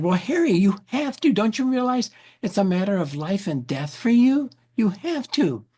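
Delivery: high, pressing insistence; urgent doctor. Retold or imitated. Doctor urging Harry he must take the meds, high and urgent. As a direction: push him hard, pitch climbing.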